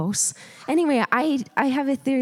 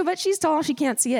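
usual speaking voice

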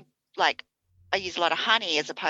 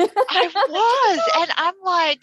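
high, loud, laughing through the words; 10 semitones higher, 7 dB louder, much slower than usual. Real, not acted. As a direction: crack up while saying it. Laughing at the absurd story.

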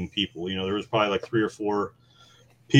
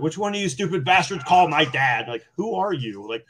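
higher angry mock yell; retold scream; 6 semitones higher than usual. Patient storming the hospital over the call, mild mock yell. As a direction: rant at the staff like a furious patient. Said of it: "I just started screaming".

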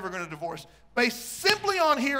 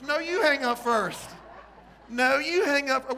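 higher, slower, giggling lovestruck voice; giggly late night call; 4 semitones higher, 4 dB louder, slower than usual. Retold or imitated. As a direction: tease your sweetheart, laughing. Acting out late night phone call.

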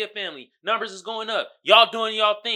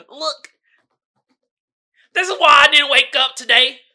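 loud mock bully; 5 semitones higher, 10 dB louder than usual. Voicing bully insult.

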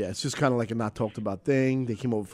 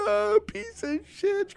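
mock crying wife; much slower than usual. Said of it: "ripping me in the car".

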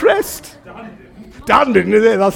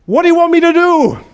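loud, high call for instructions; desperate shout; 7 semitones higher, 6 dB louder than usual. Acted. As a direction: shout up from the cliff side. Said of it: "he shouts out".

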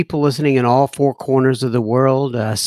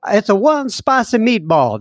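put-on hammy Italian accent, pitched up; mock Italian tourist accent; bad Italian accent; 6 semitones higher than usual. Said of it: "that's my bad Italian".

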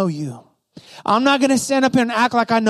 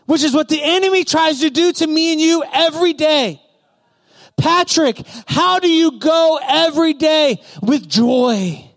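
impassioned preaching; 6 semitones higher than usual. Real, not acted. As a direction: preach it hard, stretching the key words. Preaches at full force.

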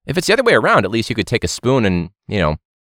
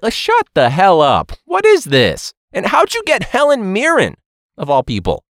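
exasperated rant; 9 semitones higher, 3 dB louder than usual. Real, not acted. Ranting at the nature show.

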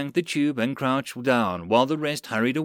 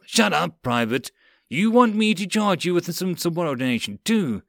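high, angry threat; screamed rebuke; 5 semitones higher than usual. Acted. Lieutenant to interrupting private, screamed rebuke. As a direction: cut in and shout them down. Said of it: "screamed at the Marine".

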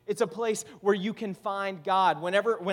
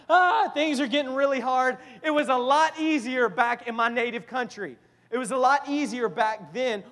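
loud, higher-pitched lament; acted complaint; 6 semitones higher, 6 dB louder than usual. Abram longs for his native country, acted exasperation. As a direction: groan about hardship with a raised, exasperated voice.

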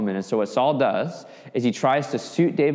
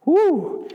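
high, loud whistling whew; reacting to the speech with awe; impressed whew; 5 dB louder than usual. Real, not acted. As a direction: blow out a big amazed whew. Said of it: "Now that is some serious".

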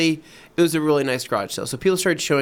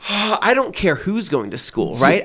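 exasperated rant; 5 semitones higher, 4 dB louder than usual. Retold or imitated. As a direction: vent at the guilt trip. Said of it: "And I go".

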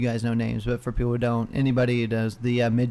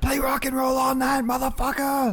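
put-on drunken heckler; 12 semitones higher than usual. Drunk heckler demanding songs from the musician, high rowdy holler. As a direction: holler a rowdy request at the band. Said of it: "shout crazy fucking songs at you".

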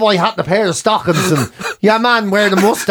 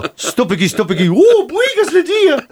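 mock Irish line; 7 semitones higher than usual. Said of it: "in Irish he used to do on stage".

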